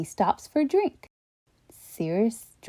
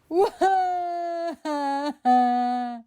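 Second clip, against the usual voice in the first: mock sobbing; 6 semitones higher than usual. Steve crying.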